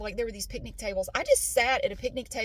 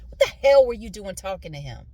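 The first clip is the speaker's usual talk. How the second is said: angry accusation; 3 semitones lower, 8 dB louder than usual. Retold or imitated. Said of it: "Talking about the husband of the husband".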